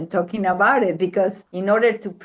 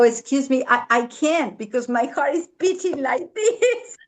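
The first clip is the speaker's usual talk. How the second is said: retold fear; 6 semitones higher than usual. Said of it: "And I go".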